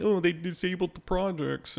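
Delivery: mock complaining. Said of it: "people were complaining".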